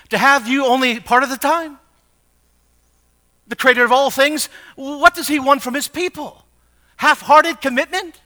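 high, loud, impassioned preacher; full-force preaching. Acted. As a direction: preach it hard, pressing each question on the listeners.

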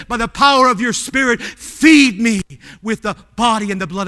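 loud, high cry to God; impassioned pulpit prayer; fervent prayer. Shown as a demonstration. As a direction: pray it out with full fervour. Preacher models a fervent communion prayer.

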